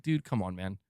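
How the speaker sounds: normal talking voice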